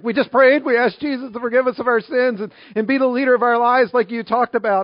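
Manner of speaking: mock-emotional couple pouring it out in a high voice; imitating tearful couple. Crying couple telling the pastor their story, mock tearful. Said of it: "they're just, like, crying".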